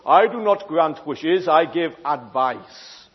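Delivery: low, slow, grand genie voice; stern genie. Acted. Genie refusing Jimmy's wishes, low and slow. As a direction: lay down the law like a solemn genie. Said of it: "And the genie goes".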